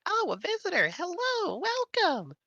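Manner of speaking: very high squeaky little voices; fairy folk voice. Acted. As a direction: greet like tiny fairy folk.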